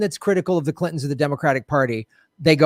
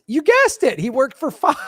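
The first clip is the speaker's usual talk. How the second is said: gleeful reveal; 8 semitones higher than usual. Real, not acted.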